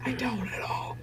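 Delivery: hushed whisper. Acted. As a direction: keep it to a whisper. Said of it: "Why are we whispering this?".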